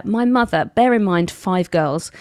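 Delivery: ordinary talk, nothing marked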